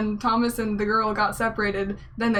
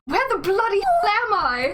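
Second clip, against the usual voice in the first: put-on British accent; 9 semitones higher than usual. Girl waking in the box, hammed-up British accent. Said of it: "But I'm being British".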